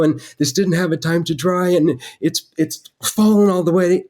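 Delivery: high-pitched, panicky stammering voice; Truman Capote. Retold or imitated. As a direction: plead your excuse in a high, tipsy-sounding pitch. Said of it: "my voice was sounding like Truman Capote at happy hour".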